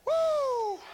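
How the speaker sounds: high, loud whoop; preacher whoops in praise; ecstatic woo. Real, not acted. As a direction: let out a joyful whoop. Ecstatic exclamation.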